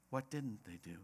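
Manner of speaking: low, very soft "What didn't they do"; right question posed. Shown as a demonstration. Preacher giving congregation the right question, softly and low. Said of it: "The question is what".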